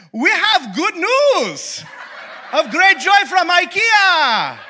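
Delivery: very high, loud, very slow proclamation; hammed-up heavenly choir; grand Swedish angels. Clone angels announcing news from IKEA, grand and slow. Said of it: "they were just like".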